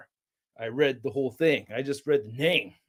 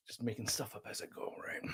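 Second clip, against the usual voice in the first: breathy whisper, no voice at all; 7 semitones lower, 20 dB quieter than usual. Real, not acted. Streamer whispering a hello to chat, hushed. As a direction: hush it down to a whisper.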